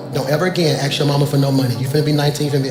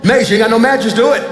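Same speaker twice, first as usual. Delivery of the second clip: higher and louder, half laughing; stern daddy shout; 6 semitones higher, 5 dB louder than usual. Retold or imitated. Dad shouting at his son about the mattress, laughing yell. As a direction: call it out to your son like a tough dad. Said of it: "I'm that type of daddy".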